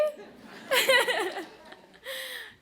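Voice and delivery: high-pitched, emotional giggle; real high overwhelmed laughter. Real, not acted. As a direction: burst into a high laugh, overcome. Overwhelmed honoree starts her thanks.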